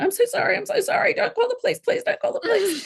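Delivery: high, pleading imitation of the husband; pleading apology. Wife imitating husband begging her not to call the police, high pleading. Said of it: "immediately then started going".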